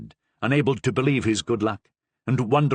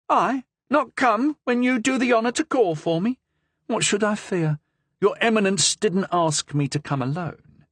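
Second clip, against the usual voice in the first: put-on lively young woman, higher, with a Languedoc accent; 10 semitones higher than usual. A narrator's reading. Madame Cavrois answers the Cardinal boldly, Languedoc accent. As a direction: bold Languedoc lilt, nose in the air. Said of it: "with a strong Languedoc accent".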